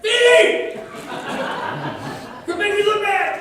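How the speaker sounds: put-on high, very loud comic shout at his kid; mock scolding yell. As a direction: holler across the track as an embarrassed dad. Acting himself yelling at his child.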